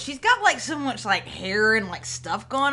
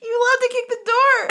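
amused outburst; 12 semitones higher, 5 dB louder than usual. Real, not acted. Amused outburst at player's move.

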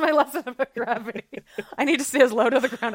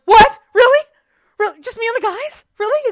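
high, giddy surprise; mock excited disbelief; 12 semitones higher, 10 dB louder than usual. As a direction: squeal it like you can't believe the invite. Acts Seth's excited surprise at invitation.

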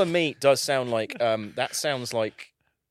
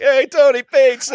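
high, slow hammed-up Italian accent; 13 semitones higher, 15 dB louder than usual. Girlfriend greets him in a hammed-up Italian accent. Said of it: "she does it in an Italian accent".

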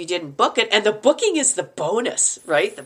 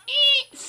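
high mock squeal; little high-pitched fan squeal; starstruck squeal. Meeting an actor she admires, high mock squeal. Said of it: "I was ready to go".

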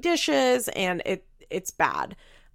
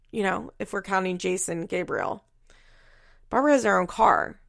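normal talking voice

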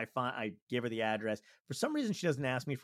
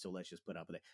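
much softer, lower private thought; quiet reasoning with yourself; inner thought; 6 semitones lower, 15 dB quieter, faster than usual. Acted. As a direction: say it under your breath, as if thinking. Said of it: "I'm always in my head like".

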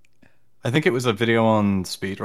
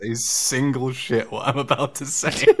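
laughing; 6 semitones higher, 7 dB louder than usual. Retold or imitated. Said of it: "And I was like".

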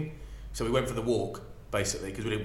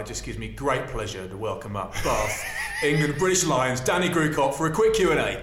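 laughing retold introduction; 2 semitones higher, 5 dB louder than usual. [name] welcomes Danny to the mic, laughing mock announcement. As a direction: build the big introduction while cracking up.

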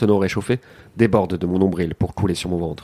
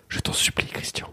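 whispered breathless plea; whispering; 8 dB quieter than usual. Acted. Begging line read in scene.

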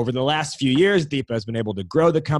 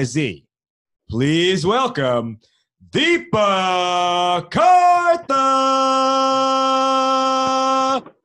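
big arena announcer build-up; ring announcer; 4 semitones higher, 4 dB louder than usual. Acted. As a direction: announce her like a prizefighter. Boxing-style guest introduction.

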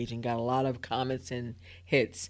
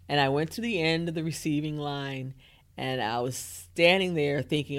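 ordinary talk, nothing marked

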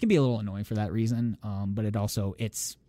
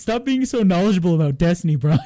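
imitating Brian; 8 semitones higher, 7 dB louder than usual. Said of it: "He's like".

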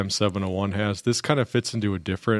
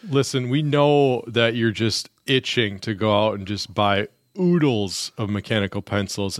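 usual speaking voice

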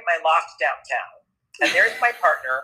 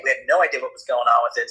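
normal talking voice

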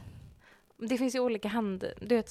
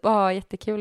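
ordinary talk, nothing marked

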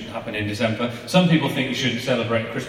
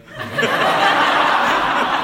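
laughing; 5 dB louder than usual. Real, not acted. Laughing at son's impression.

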